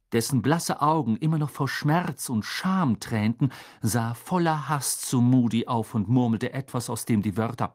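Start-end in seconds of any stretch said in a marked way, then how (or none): none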